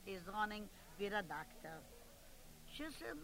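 usual speaking voice

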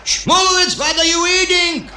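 very high, piercing yell; hammed-up high-pitched shout of alarm; shocked yell. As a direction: yell across the restaurant at him in outrage. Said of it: "and yell".

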